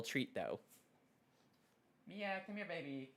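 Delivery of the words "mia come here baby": soft, high coaxing call to the dog; coaxing pet. Real, not acted. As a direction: gently lure the timid dog. Calling the scared dog.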